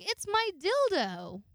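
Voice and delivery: high, loud and slow, proud reveal; bold announcement. Retold or imitated. Showing her new dildo to the roommate, loud and proud.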